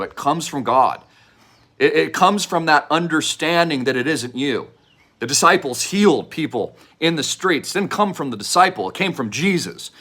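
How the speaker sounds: ordinary talk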